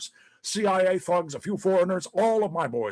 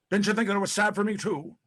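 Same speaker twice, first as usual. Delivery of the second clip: ordinary delivery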